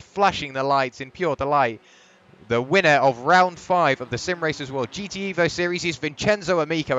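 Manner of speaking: loud, high call of "Vincenzo Amico"; excited winner call. Real, not acted. Commentator crowning the round five winner, excited and loud. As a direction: announce the race winner at full voice.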